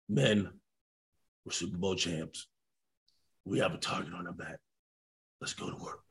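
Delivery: very low, soft, spaced-out phrases; hushed coach speech. Retold or imitated. Coach to silent champion team, hushed and grave. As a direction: sink to a whisper by the end.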